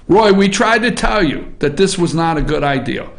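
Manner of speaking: usual speaking voice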